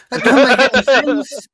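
very high, laughing protest; loud, high-pitched incredulous giggle; laughing disbelief. Real, not acted. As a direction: squeal it through real laughter.

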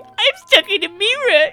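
high panicked shout, trapped; claustrophobic panic. Pretend. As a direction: yell as if trapped behind glass. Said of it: "it's just me saying".

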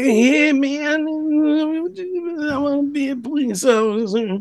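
high strained stoner voice; Bobcat Goldthwait voice. Retold or imitated. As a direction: whine it like a frantic goof. Mocking Bobcat voice.

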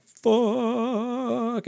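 sudden high yelp of a curse; startled curse. Retold or imitated. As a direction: blurt it out like you just hit ice. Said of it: "We're like".

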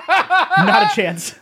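high, slow, laughing refusal; laughing at the very idea. Real, not acted. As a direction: laugh it off, drawn out. Laughs at the grading question.

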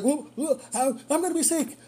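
high-pitched queasy urgency; imitated distress. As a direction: imitate a passenger about to throw up. Retold passenger about to be sick.

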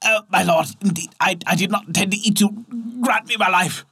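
pleading in fear. Acted. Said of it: "gasping and with tears ... trembling with fear".

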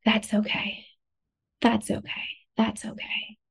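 calm yourself down gently, under your breath; soothing herself. Acted. Hand on heart, reassuring herself, soft and calm.

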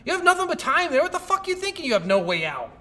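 high, loud, incredulous outburst; exasperated scolding. Acted. Player scolding the trapped game character, exasperated. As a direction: berate them as if they're an idiot.

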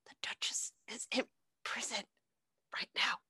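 unvoiced secretive whisper; mock conspiratorial whisper. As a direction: whisper the gossip close. Rabbit sharing secret.

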